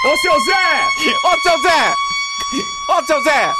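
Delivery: high, loud call across the school. Acted. Host shouts for seu Zé like calling the school inspector.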